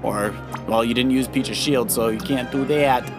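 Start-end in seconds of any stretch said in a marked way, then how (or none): none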